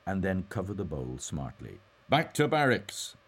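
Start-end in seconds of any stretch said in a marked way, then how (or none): 2.1-3.2 stern order, high, loud and slow. A narrator's reading. Mulligan orders the bowl back to barracks, sternly. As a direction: command it firmly, drawing out the words. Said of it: "he said sternly".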